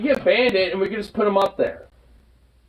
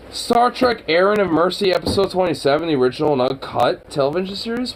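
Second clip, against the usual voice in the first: ordinary talk, nothing marked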